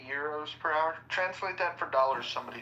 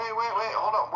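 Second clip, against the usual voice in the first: urgent interruption; 8 semitones higher, 5 dB louder than usual. Real, not acted. Interrupting to ask about accent.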